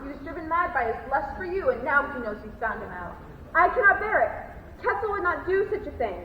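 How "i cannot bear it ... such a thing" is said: distraught. Acted. Florence distraught.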